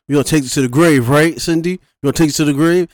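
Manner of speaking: loud, high hammed-up killer threat; mock menacing threat. Retelling the killer choking Cindy, loud mock menace. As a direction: bellow it like the cheesy movie killer.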